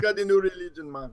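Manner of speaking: high, playful Jamaican Rasta voice; Rastafarian accent. Pretend. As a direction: put on a laid-back Rasta accent. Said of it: "Are you doing the Rastafarian accent today?".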